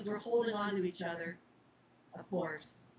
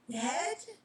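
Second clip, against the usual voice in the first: whimper; 9 semitones higher than usual. Acted. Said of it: "Winifred whimpered".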